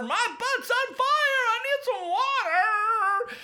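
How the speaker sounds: very high, slow howling wail; pained scream. Acted. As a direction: howl with a burning backside. Bullies' backsides on fire.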